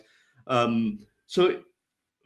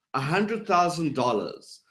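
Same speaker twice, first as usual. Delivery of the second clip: "dollars" falling to a full stop; authoritative falling inflection. Shown as a demonstration. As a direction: make a statement, going down on "dollars". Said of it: "if I go down at the end of my statement".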